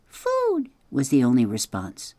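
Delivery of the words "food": high, tiny peep of a hungry chick; tiny gosling voice. Acted. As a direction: squeak it like a newborn gosling. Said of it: "That tiny voice".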